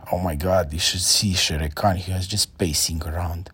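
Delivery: ordinary delivery, nothing marked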